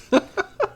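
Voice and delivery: short high giggle; a quick excited giggle; giddy laugh. Real, not acted. Vlogger giggles, eager to use the papers now.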